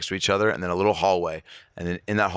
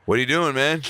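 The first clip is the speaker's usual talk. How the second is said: put-on drunk fan; 8 semitones higher, 3 dB louder than usual. Imitating drunk fan questioning star.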